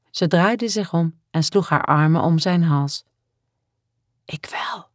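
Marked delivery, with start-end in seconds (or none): 4.2-4.9 soft unvoiced whisper. A narrator's reading. Woman confessing her love to the man, whispered. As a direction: whisper it intimately. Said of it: "fluisterde ze".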